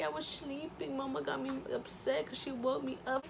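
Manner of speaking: high pretend voice for the baby. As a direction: speak for the grumpy baby, lightly. Voicing the baby's complaint.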